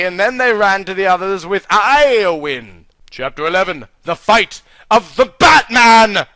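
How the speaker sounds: usual speaking voice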